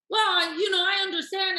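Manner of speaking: placating agent. Acted. Coach mimicking agent repeating and approving, placating. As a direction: imitate an agent fumbling to approve.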